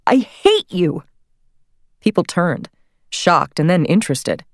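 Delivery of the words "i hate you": a sudden public "I hate you"; loud outburst. Retold or imitated. Woman blurting out her fury on Broadway, loud. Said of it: "really loudly".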